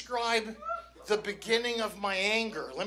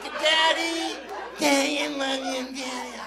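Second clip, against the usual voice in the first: whiny drawn-out child imitation; milk it like a begging little girl; mock wheedling daughter. Mimics manipulative daughter working him.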